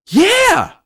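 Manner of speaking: high, loud eager yes; excited yeah. Retold or imitated. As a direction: shoot it back with excitement. Girl answers without missing beat.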